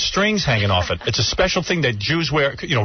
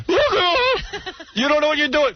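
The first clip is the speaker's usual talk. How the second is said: yelling father. Retold or imitated. Father running out at his son mowing, mock-furious screech. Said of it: "he'd start yelling at me".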